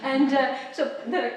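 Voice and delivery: ordinary talk, nothing marked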